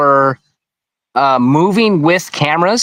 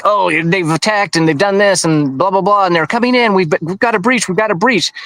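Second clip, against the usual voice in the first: higher-pitched mock alarm, climbing as it goes; mock dramatic; 4 semitones higher than usual. Staged police reporting a breach, mock dramatic. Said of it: "where they're making it dramatic".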